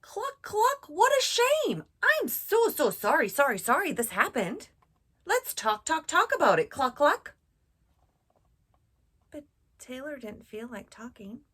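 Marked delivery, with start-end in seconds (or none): 0.0-7.3 high, loud clucking hen; chicken voice. Acted. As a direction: cluck it out like a fussy, sorry chicken. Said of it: "The chicken".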